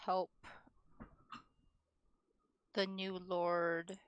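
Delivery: ordinary delivery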